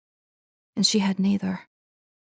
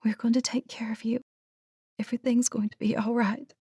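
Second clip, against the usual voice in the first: hushed, tender whisper; soothing whisper; 5 semitones higher, 5 dB quieter than usual. A narrator's reading. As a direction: promise comfort in a whisper. Soothing dying horse.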